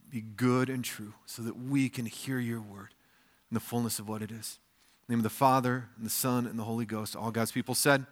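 a hushed congregational prayer; quiet prayer. Acted. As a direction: pray it hushed and reverent. Pastor prays before the reading.

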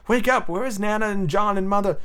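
put-on high, plaintive child's call; crying out. Michael waking John to ask for Mother, crying out. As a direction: call out high for the missing family. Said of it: "Michael would cry".

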